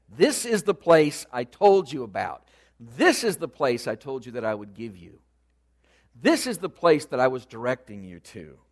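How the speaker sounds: this hit loud at the start of each sentence; forceful declaration, leaning on this; emphatic God. Acted. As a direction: punch this every time, driving the point home. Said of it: "at this point God says to him".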